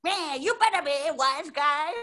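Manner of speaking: high cartoon gangster, Bugs Bunny style; forties gangster voice. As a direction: play a silly old-time wise guy. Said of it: "nineteen forties gangster ass voice".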